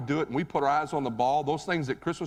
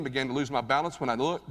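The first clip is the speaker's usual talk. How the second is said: usual speaking voice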